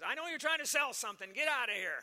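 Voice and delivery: loud, hostile shout; angry yell. Retold or imitated. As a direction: yell to chase them off. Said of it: "he yelled back".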